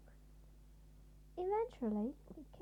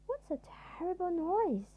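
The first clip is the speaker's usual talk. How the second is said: high, loud alarmed cry; frightened cry; 8 semitones higher, 6 dB louder than usual. A narrator's reading. Timid man woken by a noise, crying out in fright. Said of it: "he cried".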